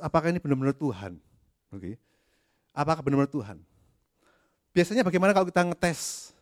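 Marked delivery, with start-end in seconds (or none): none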